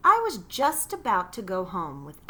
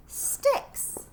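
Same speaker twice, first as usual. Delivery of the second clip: much higher whispered scoff; scoffing disbelief. A narrator's reading. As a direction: breathe it out in mock amazement. Scoffing at a house of sticks.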